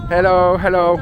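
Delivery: loud, high shouted hello; calling out. Real, not acted. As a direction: call out loudly to get their attention. Calling to children.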